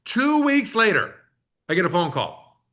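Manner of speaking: usual speaking voice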